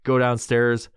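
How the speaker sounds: ordinary delivery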